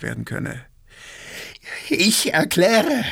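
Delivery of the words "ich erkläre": slow, high croaking; dry-throated croak. A narrator's reading. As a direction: force it out through a dry throat. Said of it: "krächzte Gaganow, plötzlich war seine Kehle trocken". (krächzend, mit trockener Kehle)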